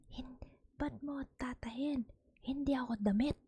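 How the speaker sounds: a hint of an offended old man protesting; indignant drunk grandpa. Grandpa's retort repeated.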